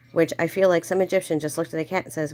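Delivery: normal talking voice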